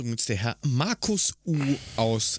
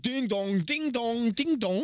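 rising ding dong chime; bell imitation; 9 semitones higher than usual. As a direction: ring it like a bell climbing higher. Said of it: "Nicht so Werbung".

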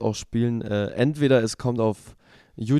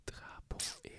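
whispering; 22 dB quieter than usual. Real, not acted. Puzzling the word out alone.

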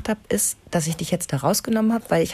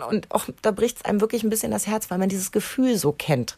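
ordinary talk, nothing marked

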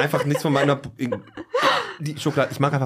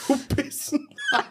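laughing; 4 dB quieter than usual. Acted. Teasing joke through laughter.

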